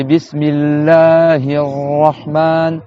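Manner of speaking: Bismillahir Rahman, slow, long held R and vowels; held doubled letters. Shown as a demonstration. Teacher reciting for students, Bismillahir Rahman slow and held. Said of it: "le shadda, c'est".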